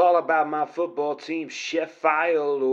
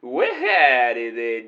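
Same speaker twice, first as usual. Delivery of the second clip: put-on cowboy accent; 4 semitones lower than usual. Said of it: "interesting accent you've got there".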